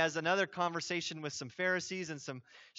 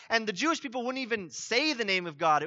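ordinary talk, nothing marked

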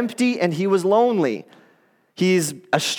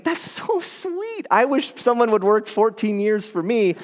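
swooning women; 3 semitones higher than usual. Retold or imitated. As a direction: coo it like a smitten fan. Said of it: "the women are thinking".

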